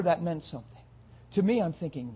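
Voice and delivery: ordinary talk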